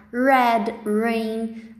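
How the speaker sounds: "red, rain", each with an English R; English initial R. Shown as a demonstration. Teacher lists R words for students, English initial R. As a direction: model "red" and "rain" without any H sound. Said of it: "E quando começa com R, a gente fala".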